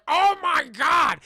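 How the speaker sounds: high, loud, slow horrified shout; shocked shout. Retold or imitated. Old lady walking in on the birth, shocked shout. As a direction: recoil in horror.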